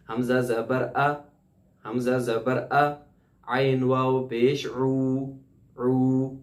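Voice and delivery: slow syllable-by-syllable drill; slow recitation drill. Shown as a demonstration. Teacher leading students in slow repetition. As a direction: model each sound for students to echo. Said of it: "تو آپ میرے ساتھ دہرائیے".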